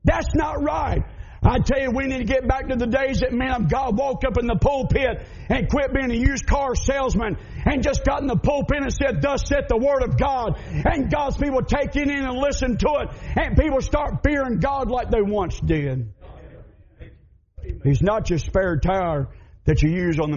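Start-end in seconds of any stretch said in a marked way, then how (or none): 0.0-1.0 repeated outraged cry; indignant shout. Retold or imitated. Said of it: "They said". 1.4-16.1 high, ringing sermon delivery; old-time preacher in full flow; fiery preaching. Acted. Rising preaching at full force.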